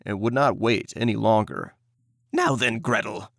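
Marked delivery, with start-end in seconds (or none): 2.3-3.3 lightly voiced impatient witch. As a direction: hurry the girl along. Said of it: "she cried to the girl".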